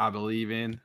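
low, soft, put-on solemn compliment; deep voice. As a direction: drop deep and bestow praise quietly. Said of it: "Bro, go, go deeper".

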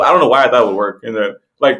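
ordinary delivery

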